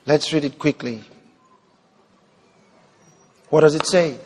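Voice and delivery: normal talking voice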